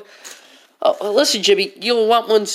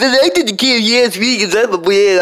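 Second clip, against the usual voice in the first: put-on bratty kid voice; 4 dB louder than usual. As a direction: brag like a spoiled kid.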